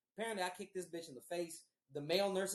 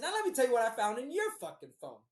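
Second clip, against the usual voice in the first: fast, loud, raised-pitch retort; telling her off; 6 semitones higher, 7 dB louder, faster than usual. Retold or imitated. Man confronting girlfriend about her phone, heated. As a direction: round on them in a retold quarrel. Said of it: "I got on her ass".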